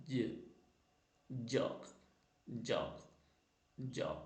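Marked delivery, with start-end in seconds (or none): 0.1-0.2 "j" as "juh"; soft g sound. Shown as a demonstration. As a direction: say "j" as the first sound of George, not "jay". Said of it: "letter pronounce ചെയ്യുന്നത്".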